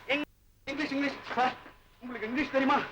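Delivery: soft, repeated plea; helpless murmur. Acted. Seeking someone who reads English, a soft helpless murmur.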